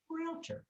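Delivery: "Realtor" with the first syllable stretched; two-syllable "Realtor", long start. Shown as a demonstration. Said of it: "first syllable's longer".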